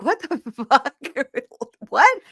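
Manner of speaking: laughing, rising to a high squeaky What; mock shock. Retelling Lauren's reaction, mock high-pitched shock. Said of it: "And Lauren's like".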